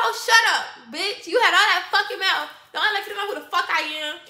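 loud retold telling off; mock angry yell. She tells off the girl who talked shit, loudly. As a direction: snap at her with contempt. Confronting the talkative girls.

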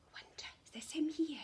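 fast hushed whisper. Acted. Teacher whispers while turning over a card. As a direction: breathe it like a secret.

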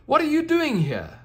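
very high and loud eager question; a thrilled girl asking the unicorn; excited little girl. Acted. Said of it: "exclaimed the little girl excitedly".

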